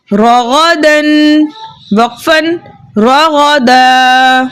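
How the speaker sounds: slow, level chant with elongated syllables; practice recitation. Shown as a demonstration. Teacher leads pupils through practice, slow chanted spelling. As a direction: spell and blend slowly, holding the endings. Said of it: "आइए मश्क करते हैं".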